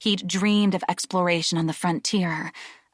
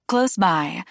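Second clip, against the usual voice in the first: usual speaking voice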